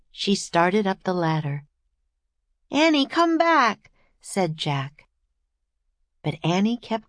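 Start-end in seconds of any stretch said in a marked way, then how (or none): 2.7-3.9 put-on high, raised boy's call; calling her back. A narrator's reading. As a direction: call up to the climbing sister urgently. Brother calls climbing sister back.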